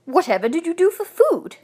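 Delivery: high, loud, wondering; amazed question. A narrator's reading. Husband asking the bear about food, amazed. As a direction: ask in astonished concern.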